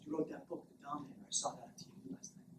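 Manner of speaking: very soft, whispery creeper. Acted. Creeper fan to the author, hushed creeper voice. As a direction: murmur it close, like a creepy fan. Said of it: "this creeper comes up behind me".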